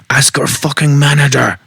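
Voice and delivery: screamed in a bad French accent, high and loud; botched French-accented scream; terrible French accent. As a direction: yell it like an angry French chef, accent slipping. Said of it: "that was a terrible French accent".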